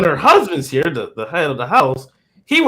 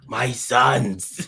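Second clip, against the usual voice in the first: lightly imitated cartoon mentor voice; softer, lower imitation of a wise master; hint of an old sensei; Splinter; 5 semitones lower, 4 dB quieter than usual. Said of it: "his Splinter, uh, voice".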